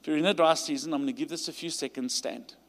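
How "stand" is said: usual speaking voice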